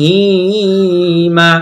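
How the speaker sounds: "ইব্রাহিমা" slow, with "রা" and "হি" stretched long; drawn-out vowels. Shown as a demonstration. Teacher showing where to draw it out, slow stretched "ইব্রাহিমা". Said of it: "এখানে র টেনে পড়বো".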